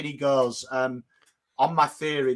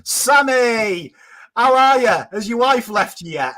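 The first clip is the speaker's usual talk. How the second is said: chirpy boss voice; 8 semitones higher, 8 dB louder than usual. Retold or imitated. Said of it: "He's like".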